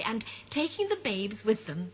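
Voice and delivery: usual speaking voice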